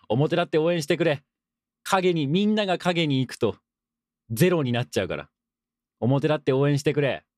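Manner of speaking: playful protest, voice lifted; emphatic plea. Real, not acted. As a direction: plead with listeners to cheer openly. Mock plea answering a listener's letter.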